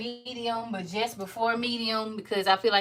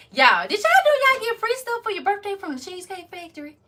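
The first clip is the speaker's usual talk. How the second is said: much higher, eager question; bursting to share the news; high, excited gossip; 10 semitones higher, 4 dB louder than usual. Real, not acted. Sudden news about birthday freebies.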